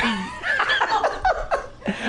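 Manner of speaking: squealing laugh; high burst of laughter. Real, not acted.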